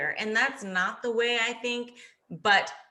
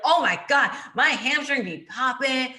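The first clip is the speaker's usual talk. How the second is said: excited for herself; 4 semitones higher, 4 dB louder than usual. Retold or imitated. Bragging to herself about her hamstrings, in excited self-praise. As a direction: show off, delighted with yourself. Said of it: "I was so, like, excited for myself".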